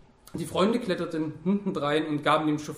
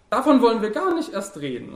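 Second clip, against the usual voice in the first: loud, higher, firm interruption; cutting in; 7 semitones higher, 7 dB louder than usual. A narrator's reading. Labude interrupts the fascist's speech, loudly cutting in. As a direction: cut him off sharply. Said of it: "unterbrach ihn Labude".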